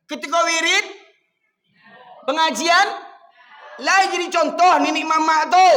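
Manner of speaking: high, very loud sermon shout; shouted rebuke. Real, not acted. Preacher demands elders set an example, shouting. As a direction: hammer each phrase at full force.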